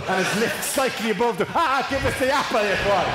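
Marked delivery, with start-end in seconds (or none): 1.5-3.1 high, imitated begging outburst; mock desperate plea. Dieter jumping at a dangled apple, mock desperate. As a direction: beg for it in a higher put-on voice.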